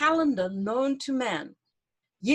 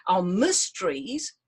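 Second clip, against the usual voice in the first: normal talking voice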